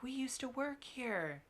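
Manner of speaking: lightly gushing former worker, stretched out; mock eager customer. Former restaurant worker announcing themselves to staff, mock eager.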